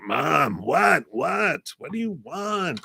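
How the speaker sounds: high playful call to Mom. Pretend. Calling out to spirit mom.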